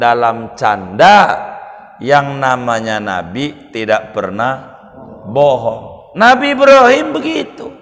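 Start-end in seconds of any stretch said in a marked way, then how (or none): 6.2-7.7 sudden call out. Real, not acted. Seruan tiba-tiba.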